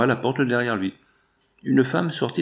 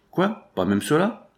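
exclaimed dismay; 8 semitones higher, slower than usual. A narrator's reading. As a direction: exclaim in disbelief, starting high. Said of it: "s'écria le contremaître".

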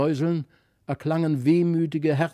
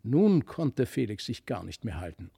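ordinary delivery

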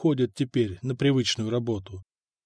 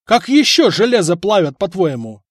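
louder, higher, exasperated; angry outburst; 5 semitones higher, 8 dB louder than usual. A narrator's reading. Blacksmith arguing with his wife, angry. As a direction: bark back at her. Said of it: "взорвался кузнец".

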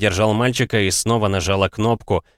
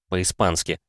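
plain reading voice

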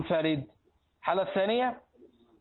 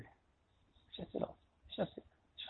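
fast breathy whisper; teasing whisper; 16 dB quieter, much faster than usual. Real, not acted. Whispering to the class that nobody asks. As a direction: whisper it quickly as a playful aside.

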